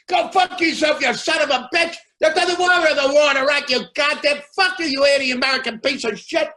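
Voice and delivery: high, loud furious heckling from the crowd; angry heckler shout. Retold or imitated. Heckler in the crowd screams at the comic, furious. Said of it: "you hear from the audience".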